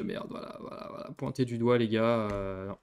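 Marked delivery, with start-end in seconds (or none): 0.0-1.1 voiceless resigned murmur; hushed voilà voilà; resigned whisper. Real, not acted. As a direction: give up under your breath. Resigned muttering.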